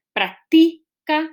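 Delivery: práctica said slowly as prac-TI-ca; stress on TI. Shown as a demonstration. Teacher offering the middle option, stress on TI. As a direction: stretch and punch the middle syllable of práctica. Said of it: "dónde estoy poniendo la, la mayor, ah, fuerza".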